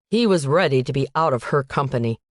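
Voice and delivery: usual speaking voice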